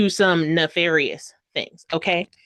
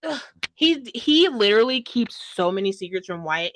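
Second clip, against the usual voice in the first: ordinary talk, nothing marked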